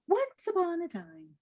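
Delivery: high storybook "once upon a time"; storyteller opening. Shown as a demonstration. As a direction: lift it up like opening a fairy tale. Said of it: "think of it as that".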